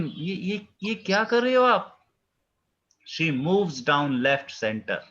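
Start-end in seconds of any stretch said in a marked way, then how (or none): 0.0-2.1 high, stunned disbelief; astonished question. Acted. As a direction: ask it as if you can't believe your eyes. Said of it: "हैरान होके".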